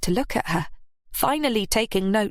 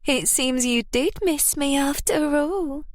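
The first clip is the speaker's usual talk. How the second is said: high, teasing seductive voice; Chastity teasing her lover in bed; purring; 7 semitones higher than usual. A narrator's reading. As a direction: tease him, pitched up. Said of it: "she purred".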